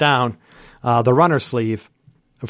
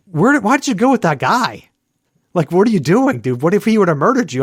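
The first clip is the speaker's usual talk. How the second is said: higher-pitched imitation of scolding friends; alarmed disbelief; 5 semitones higher than usual. Friends questioning him after he went off with a stranger, mock alarm. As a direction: act out baffled, reproachful questioning.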